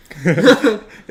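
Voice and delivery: loud, high burst of laughter. Real, not acted. Reader breaks into a loud, high laugh mid-story. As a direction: laugh out loud, unable to hold it in.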